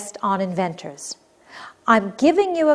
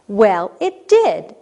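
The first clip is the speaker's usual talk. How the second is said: exclaimed; 6 dB louder, slower than usual. Shown as a demonstration.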